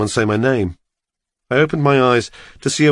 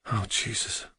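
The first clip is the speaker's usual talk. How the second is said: a much softer, barely voiced "Oh, Jesus"; stunned hush; 14 dB quieter than usual. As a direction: let it slip out, hushed in dismay. Hearing his friend's body was found.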